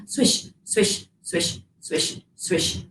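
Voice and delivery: breathy swishing through grass, softly; whispered swish sounds. Shown as a demonstration. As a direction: brush the words like grass. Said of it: "Can you do that?".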